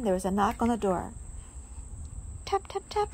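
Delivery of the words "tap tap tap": high, light tap tap tap; knocking sound. A narrator's reading. Knock on door.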